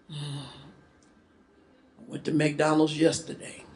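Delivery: low, soft monotone; bored, down. Acted. Woman at dinner out, bored monotone. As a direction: sigh it out without interest. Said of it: "bored or feeling down".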